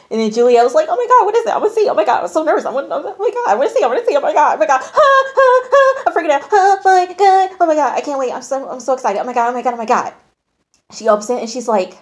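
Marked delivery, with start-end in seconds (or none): 0.9-10.1 very high, frantic squealing imitation; nervous excitement. Imitating Juliet awaiting her painting reveal, nervously overexcited. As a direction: babble in a high mock freak-out, gasping between words. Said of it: "Juliet's nervous".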